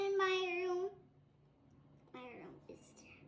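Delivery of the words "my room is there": usual speaking voice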